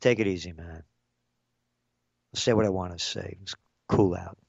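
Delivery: low, soft, unbothered reply; retold quiet brush-off; calm rebuff. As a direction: play it cool and defuse the argument. Retelling his calm reply in bar.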